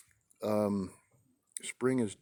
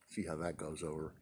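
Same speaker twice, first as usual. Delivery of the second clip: whispered aside; 5 semitones lower, 12 dB quieter than usual. Real, not acted. Aside after own joke.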